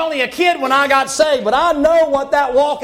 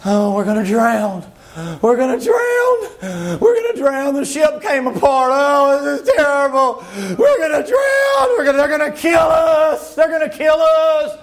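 mock panicked wailing. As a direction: cry out like a terrified castaway, overdone. Preacher acts out panicking sailors.

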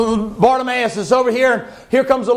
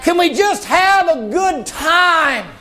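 higher, slow, shouting preacher; fervent slow shouted exhortation; preaching at full force; 4 semitones higher, slower than usual. Acted. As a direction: drive it home to the congregation.

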